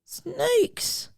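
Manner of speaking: a squeaky warning from grandpa; lightly voiced grandfather. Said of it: "said her grandfather".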